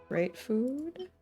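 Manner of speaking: ordinary talk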